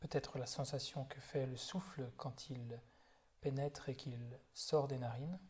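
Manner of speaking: calm, unhurried body-scan guidance with long silences; guided meditation. Real, not acted. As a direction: guide them gently through the body, leaving space after each phrase. (méditation guidée, voix calme et posée)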